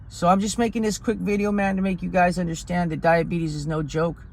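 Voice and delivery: ordinary talk, nothing marked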